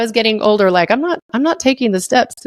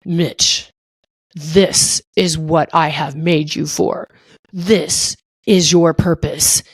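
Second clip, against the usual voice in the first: much lower and slow, a grave heavenly pronouncement; God's voice; 4 semitones lower, slower than usual. Retold or imitated. God telling Mitch his purpose, low and slow. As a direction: speak as God giving a life purpose. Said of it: "what's up, God?".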